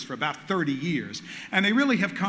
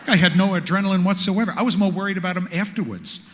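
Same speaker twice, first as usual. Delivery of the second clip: ordinary talk, nothing marked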